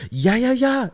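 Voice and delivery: high, loud mock cheer; imitating cheering teammate. Imitating his teammate cheering him on, high and loud. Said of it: "He's the biggest cheerleader".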